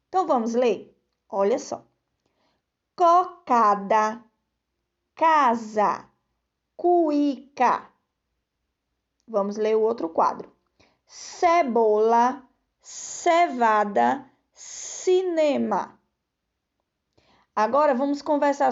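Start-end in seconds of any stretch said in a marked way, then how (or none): 2.9-7.9 put-on each word slow and spaced out for children; slow reading aloud. Shown as a demonstration. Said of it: "Leiam as palavras abaixo em voz alta com a professora". 11.0-16.0 cebola, cevada, cinema drawn out slowly; slow reading aloud. Shown as a demonstration. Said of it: "Leiam as palavras abaixo em voz alta com a professora".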